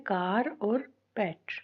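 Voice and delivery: कार और पेट slowly, with a pause before पेट; separated syllables. Shown as a demonstration. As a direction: set कार and पेट clearly apart. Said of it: "syllables हम यहाँ पे कैसे find".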